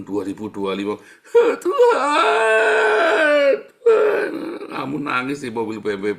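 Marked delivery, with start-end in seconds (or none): 1.6-4.3 loud high-pitched wail, drawn out; hammed-up sobbing cry to God; mock crying. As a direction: bawl it out like an overblown tantrum. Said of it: "sambil nangis ya di dalam mobil".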